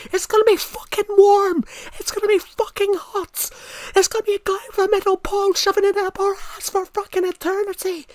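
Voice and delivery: furious high Scottish yell; Scottish accent. Shown as a demonstration. Streamer showing guys hell in Scotland, screamed Scottish accent. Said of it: "hell in a Scottish accent".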